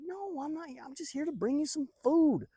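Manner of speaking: high, insistent protest; defensive protest. Acted. David tells brothers he brought food, protesting.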